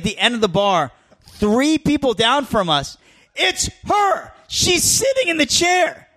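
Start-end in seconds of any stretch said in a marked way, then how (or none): 3.4-6.1 high, giddy retelling, barely holding it in; can't believe who just walked in; high excited whisper-shout to a friend at the bar; excited disbelief. Retold or imitated. Star spotted at the bar.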